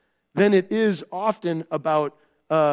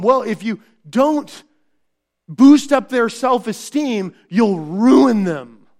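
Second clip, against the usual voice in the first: louder, slow mocking of the advice; mock worldly advice; 3 semitones higher, 7 dB louder, slower than usual. Preacher mocking culture's warning to parents, slow and loud. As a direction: parody the culture's warning with weight.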